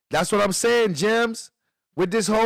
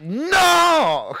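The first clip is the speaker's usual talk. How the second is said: high, loud "no"; protesting outcry; 7 semitones higher, 4 dB louder than usual. Real, not acted. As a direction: cry out in shocked-but-amused protest. Mock outcry at a dark joke.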